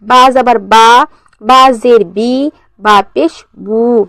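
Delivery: slow recitation with pure ee in "बी" and oo in "बू"; deliberate maruf demo, clear long "बी" and "बू"; maruf reading. Shown as a demonstration. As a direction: hold "बी" as ee and "बू" as oo. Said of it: "मारूफ की तरीके कैसे पढ़ेंगे हम".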